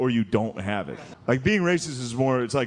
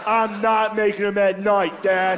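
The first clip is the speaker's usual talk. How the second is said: high, slow, drawn-out protest; protesting denial; 6 semitones higher, slower than usual. Acted. Son accused by his dad, slow high-pitched denial. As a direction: deny it to Dad, pitched up and stretched.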